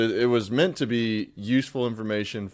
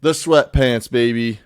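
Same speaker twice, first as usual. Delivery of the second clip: louder, rising excitement over each item; hyped-up listing; 3 semitones higher, 10 dB louder, much slower than usual. Real, not acted. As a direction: sell the winter gear like a hype man. Hyped listing winter gear.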